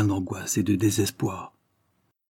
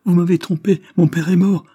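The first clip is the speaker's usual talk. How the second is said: much higher and louder anguished outcry; crying out; 9 semitones higher, 9 dB louder than usual. A narrator's reading. Daughter sure her father is dead, crying out. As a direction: cry out in alarm, voice raised high. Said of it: "s'écria-t-elle".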